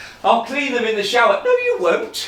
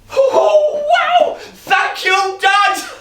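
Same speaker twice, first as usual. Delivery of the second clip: much higher, louder shout of joy with a laugh; delighted shout; 8 dB louder, much slower than usual. Acted. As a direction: shout thanks to dad, overjoyed. Delighted at Christmas present.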